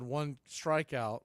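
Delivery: usual speaking voice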